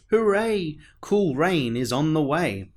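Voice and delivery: high, loud hooray of relief; bright excited cheer. Acted. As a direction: cheer as the rain clouds come. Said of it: "the sunburned Charlie cheered".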